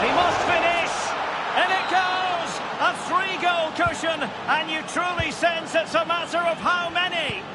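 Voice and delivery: high, ringing commentator shout as the ball goes in; excited goal call. Acted. Commentator calling a goal to viewers, excited high shout. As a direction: bellow the goal to the crowd.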